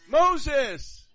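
high, loud call of the name; booming shout. Pretend. The voice of God shouting back down to Moses, loud and high. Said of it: "the voice shouted back".

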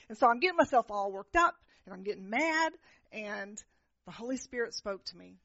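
ordinary talk

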